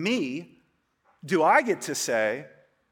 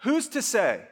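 lightly voiced everyday skeptic, raised pitch; casual modern shrug; 4 semitones higher than usual. Shown as a demonstration. Preacher voicing how people ask it today, casual. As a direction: toss off Who's as a casual challenge. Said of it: "Today, we would say it more like this".

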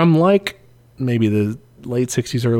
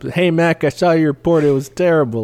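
mock complaint call, pitched up; Joe Biden; 5 semitones higher than usual. President phoning to complain about a report, mock scolding. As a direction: pretend to scold someone over the phone. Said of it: "what is Joe Biden gonna do? Call us up".

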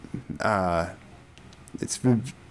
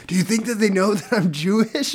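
higher, anxious whispered-feeling question, lightly voiced; mock nervous worry; 8 semitones higher than usual. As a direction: play him nervously asking his neighbour. Retelling Seth Rogen movie scene.

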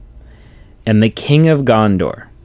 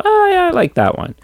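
much higher, squeaky voice of a delighted chooser; mock picky viewer; faster than usual. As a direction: play a fan picking a favourite ending, high-pitched. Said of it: "Let me go".

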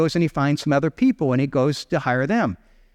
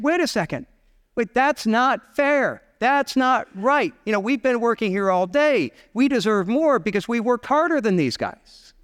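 higher-pitched, aggrieved worker's complaint; indignant protest; 6 semitones higher than usual. Acted. All-day workers objecting to equal pay, acted indignation. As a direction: protest like a cheated worker, drawing out the first objections.

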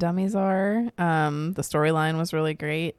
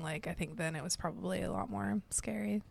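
whispered aside, much softer; 12 dB quieter than usual. Real, not acted. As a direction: drop to a breathy whisper. Quiet aside about old horror.

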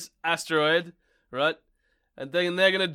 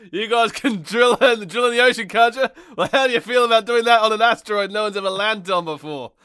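louder, higher hammed-up pitch to drillers; mock NASA recruiter; 6 semitones higher, 8 dB louder than usual. Mocking the movie's NASA recruiting drillers, loud hammed-up cheer. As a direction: sell the crazy mission with fake cheer.

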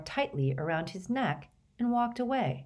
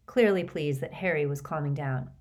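ordinary delivery

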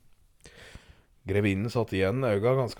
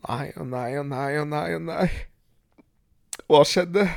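a touch of a weeping countess; sobbing countess. Acted. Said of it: "blant hulk og gråt". (gråtende grevinne, svakt spilt)

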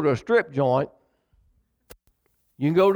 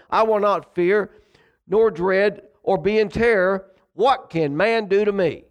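scripture preaching; 4 semitones higher than usual. Acted. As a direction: proclaim it like a pulpit promise. Preaching scripture read aloud.

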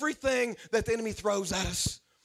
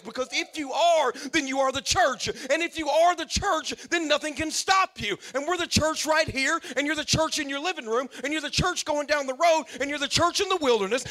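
higher, louder, building preacher's cry; full-force preaching; 5 semitones higher, 4 dB louder than usual. Real, not acted. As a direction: preach it with rising fire.

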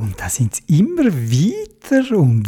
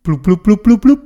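bubbling sounds; 4 semitones higher, 4 dB louder than usual. A narrator's reading. Bubbles rising underwater.

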